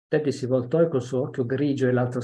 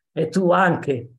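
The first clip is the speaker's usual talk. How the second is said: taunting jab; 5 semitones higher, 6 dB louder, much slower than usual. Acted. Child's parting jab.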